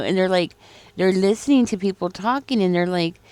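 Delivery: usual speaking voice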